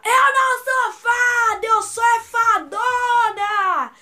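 high, very loud, slow drawn-out yell; shouted. Retold or imitated. Siblings yelling along in the cinema, shouted quote. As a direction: yell the movie line, stretching every word. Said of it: "Eu e a Giovana gritamos no cinema".

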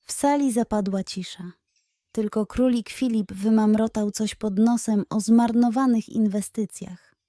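ordinary delivery

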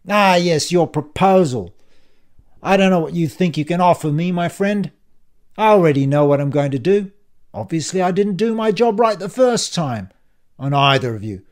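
loud, contemptuous bully; sneering villain. Acted. Ian dismisses Dan's peace proposal, loud and sneering. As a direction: sneer it out forcefully.

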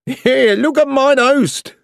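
high, loud amused call; laughing. A narrator's reading. Young soldier greeting Jock on deck, high laughing call. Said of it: "laughed a young soldier".

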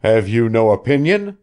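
insisting. A narrator's reading. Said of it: "Joel insisted".

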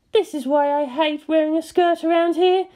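put-on high, grumbling young woman; complaining Misato. A narrator's reading. Misato complaining to Shinji about the updraft, high and peeved. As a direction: gripe about the skirt in a high voice. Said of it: "she complained".

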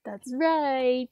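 ordinary delivery, nothing marked

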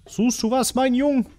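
high sing-song mock voice; creepy high voice. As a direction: lure them in, sweetly creepy. (hohe, schaurig süße Stimme)

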